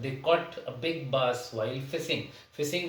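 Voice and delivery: slow, word-by-word dictation of the sentence; slow example reading. Shown as a demonstration. Teacher reads the example sentence slowly to students. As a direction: read it out slowly for the class to follow. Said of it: "reads example sentence slowly".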